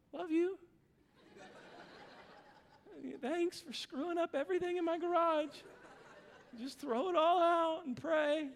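laughing tender dad. Retold or imitated. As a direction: comfort him with a chuckle. Dad kneels, comforts son.

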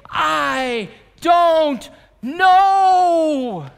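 a loud, high, very slow I don't know; despairing shout. Acted. Preacher voicing what Jesus waits to hear, a long loud cry.

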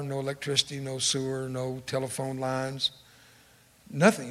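ordinary talk, nothing marked

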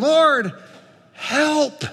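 high, slow, anguished pleading, no real scream; a parent kneeling before Jesus, mildly acted despair; desperate cry. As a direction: drag out the plea like a broken parent. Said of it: "screaming in utter desperation".

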